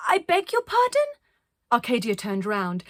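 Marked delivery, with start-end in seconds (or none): 0.1-1.1 put-on high, loud small girl calling; called question. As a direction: call through the muffling snow. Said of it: "Iris called".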